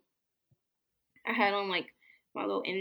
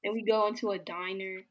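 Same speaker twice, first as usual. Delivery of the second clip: ordinary talk, nothing marked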